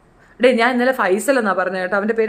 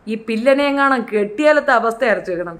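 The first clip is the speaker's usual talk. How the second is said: ordinary delivery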